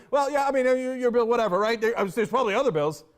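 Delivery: hammed-up dismissive excuse; mock dismissive anchor. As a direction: mimic a flustered anchor waving it away. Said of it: "you're like".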